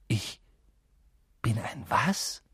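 fassungslos flüstern; geflüstert. Acted. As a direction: kaum hörbar nachfragen. (ungläubiges Flüstern)

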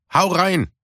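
hearty invitation; Puma encouraging. Acted. Puma urges eating. (höher, auffordernd)

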